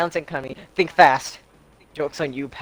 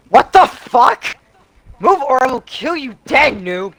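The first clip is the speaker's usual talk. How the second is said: mock rage; 9 semitones higher, 4 dB louder, slower than usual. Damien voiced again.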